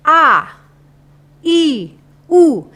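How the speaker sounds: crisp separate vowels A, I, U, pitched high; articulation demo. Shown as a demonstration. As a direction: sound out A, I and U one by one, loudly. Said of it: "artikulasi ini perubahan rongga". (artikulasi vokal A, I, U yang tegas)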